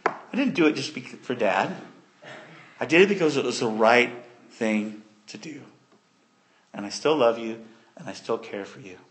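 soft, low, unhurried reassurance; comforting. Retold or imitated. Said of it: "Joseph comforts them and says".

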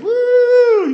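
high, loud, sustained "woo"; party whoop. Retold or imitated. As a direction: whoop like a crowd celebrating. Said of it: "you know, party".

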